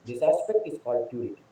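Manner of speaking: hushed repetition for weight; soft echo. Real, not acted. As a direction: let it sink in quietly. Quieter echo of the line.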